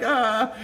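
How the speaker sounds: high-pitched mock wail; mimicked pain cry. Coworker pinned under car, mimicked pain cry. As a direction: imitate someone crying out in pain. Imitates Chuck's pained cry.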